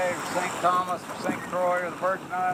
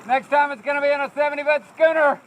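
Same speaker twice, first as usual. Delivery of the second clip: loud and high with excitement; excited shouting; 8 semitones higher, 8 dB louder than usual. Real, not acted. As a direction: call it out with joy. Excited shouting while sailing.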